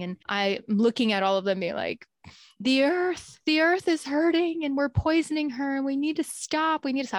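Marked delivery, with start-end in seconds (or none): 2.6-7.2 high, pleading mock wail; imitating herself blubbering, pitched up; imitated blubbering. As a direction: act out a tearful, passionate plea.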